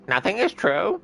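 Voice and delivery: high parrot, stress on is. Retold or imitated. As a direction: parrot echoing the phrase. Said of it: "Doing an impression of a parrot".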